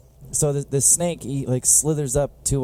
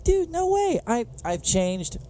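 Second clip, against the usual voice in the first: mock earnest snake; 10 semitones higher, slower than usual. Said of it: "the snake's like".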